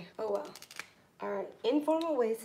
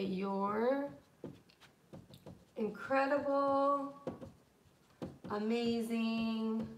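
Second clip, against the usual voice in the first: drawn-out gushing praise; 3 dB quieter, slower than usual. Shown as a demonstration. Teacher demos thanking a friend, slow gushing. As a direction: stretch each word, slowly and gushing. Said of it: "anything to that, like this".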